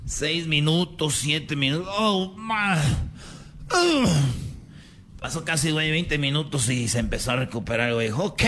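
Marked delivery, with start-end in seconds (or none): 3.7-4.0 pained cry. Real, not acted. Pain cry.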